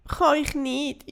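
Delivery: mock-wailing child fairy; whining. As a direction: whine it like a sulking little fairy. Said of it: "jammert das Erdbeerindli".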